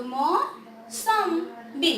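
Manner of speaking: Mosambi drawn out very slowly; slow sing-song mosambi; slow sounding out. Shown as a demonstration. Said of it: "अब जैसे मैं अगर यहाँ पे लिख दूँ".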